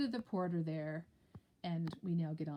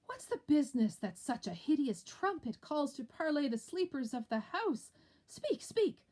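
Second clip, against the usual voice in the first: higher, louder questioning and grief; alarmed exchange; 8 semitones higher, 7 dB louder than usual. Acted. Lady Macbeth demanding news, Macduff grieving. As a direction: play the alarm.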